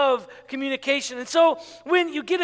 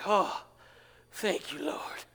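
low sigh sinking into a whisper; whispered relief; 4 semitones lower, 9 dB quieter, slower than usual. Acted. Nervous pastor learning the meeting is harmless, relieved whisper. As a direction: breathe it out in hushed relief.

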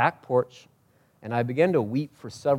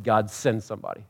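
ordinary talk, nothing marked

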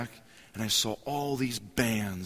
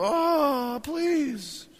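high, exasperated mock groan of teenagers; eye-rolling kids. Kids groaning at their dad's joke, exasperated and high. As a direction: roll your eyes at your dad, drawn out. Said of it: "they just go".

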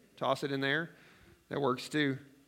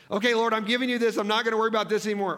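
higher, louder, fast fretful prayer; mock anxious prayer; 7 semitones higher, 6 dB louder, faster than usual. Preacher acting out his own worried prayer, fretful. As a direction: hand it over while still fretting.

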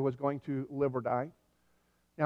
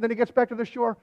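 ordinary talk, nothing marked

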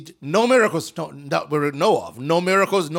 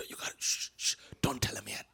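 hushed, soft conspiratorial whisper; whispered secret; 6 semitones lower, 12 dB quieter, faster than usual. Retold or imitated. Jesus whispering to his cousin John, hushed. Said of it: "John, Jesus says".

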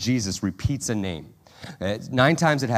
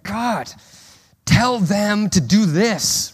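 high, drawn-out pleading to God; whiny Martha prayer; 8 semitones higher, 5 dB louder, much slower than usual. Retold or imitated. Worried believer nagging God in prayer, pleading. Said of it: "we begin to pray like Martha".

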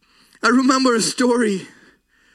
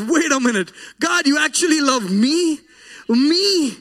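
high-pitched, rising disbelief; amazed, barely believing it; incredulous; 5 semitones higher than usual. Retold or imitated. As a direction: ask it in astonishment, climbing on each repeat. Retold astonishment at being loved by God.

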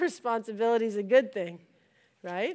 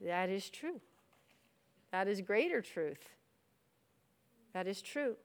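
low, soft acknowledgement; hushed, yielding reply to God; humbled acceptance; 3 semitones lower, 10 dB quieter than usual. Retold or imitated. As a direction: say it softly, letting it sink in. Acted humbled acceptance of God's answer.

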